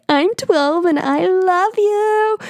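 put-on a lovesick kid confessing; gushing twelve-year-old. Twelve-year-old confessing love to her idol on radio, high and slow. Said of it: "being like".

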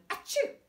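high sudden sneeze. Acted. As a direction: let out a big sneeze. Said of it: "instantly sneezed on them".

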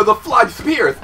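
ordinary delivery

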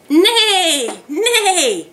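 high, drawn-out pretend neigh, falling away; horse neigh. Teacher giving children a farm sound to guess, horse neigh. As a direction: imitate a horse with two long neighs. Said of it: "I hear a horse".

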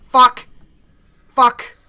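ordinary delivery

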